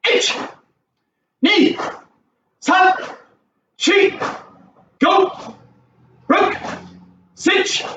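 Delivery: high, quick counting calls; fast count calls. Shown as a demonstration. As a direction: rattle off the count briskly. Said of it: "let's do ten fast".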